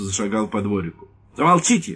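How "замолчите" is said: high, loud angry bark; furious shout. Acted. Lieutenant yelling at the children in the window, furious. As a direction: snap at the kids in fury. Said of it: "разъярённо сказал он детям".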